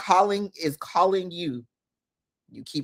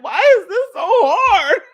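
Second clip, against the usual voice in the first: laughing complaint; 14 semitones higher, 8 dB louder than usual. Retold or imitated. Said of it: "You like".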